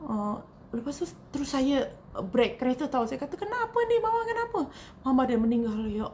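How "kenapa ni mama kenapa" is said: a touch of alarm, pitched up, reliving the moment; panicked retold question. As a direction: ask in sudden fear what happened to Mama. Learns her mother has died.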